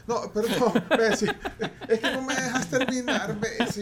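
laughing protest, pitch up high. Real, not acted. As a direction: laugh through the complaint. Laughs while protesting being interrupted.

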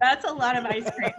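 laughing echo of the joke. Real, not acted. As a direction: say it through laughter.

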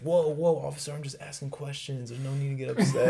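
high-pitched mock placating; backpedaling kid. Kid tells officer he's just asking, high mock backpedaling. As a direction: imitate a kid hastily calming an armed officer.

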